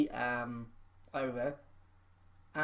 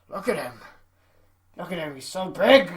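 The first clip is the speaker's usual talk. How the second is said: awestruck boss; 7 dB louder than usual. Retold or imitated. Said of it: "it's just like".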